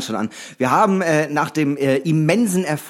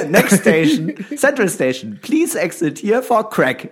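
mock train announcement; 5 semitones higher, slower than usual.

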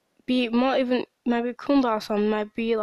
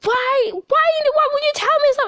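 much higher, louder, exasperated mock yell; imitating own shouting; 7 dB louder than usual. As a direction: replay her own shout at the riders. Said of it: "when I shout at, you know, people".

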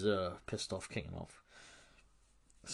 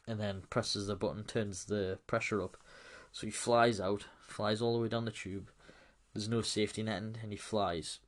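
ordinary talk

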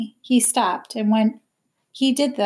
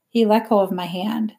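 ordinary delivery, nothing marked

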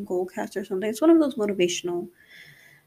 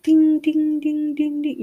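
ding ding ding, higher and louder, like a bell; mock alarm dings; 7 semitones higher, 6 dB louder, slower than usual. Speaker imitating checklists going off in her head, ringing dings. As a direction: chime them out like alarms going off.

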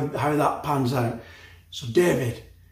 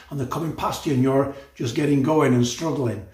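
ordinary delivery

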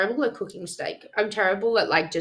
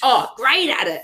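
mock hype; 6 semitones higher, 6 dB louder than usual. Real, not acted. Teasing hype of friend.

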